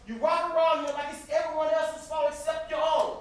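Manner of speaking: sibling rage boiling over; furious shout. Acted. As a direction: unload every grievance at full volume. Brother erupts in sibling quarrel.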